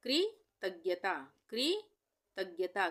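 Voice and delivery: कृतज्ञता very slow, कृ held, then तज्ञता; broken-up dictation. Shown as a demonstration. Teacher dictating a spelling word, कृतज्ञता in slow parts. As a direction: split कृतज्ञता with a pause after कृ.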